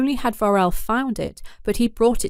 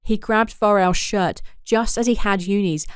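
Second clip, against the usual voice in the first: usual speaking voice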